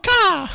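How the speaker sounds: loud high cawing squawk; crow caws. Acted. Dying bird player cries to friends, loud crow caw.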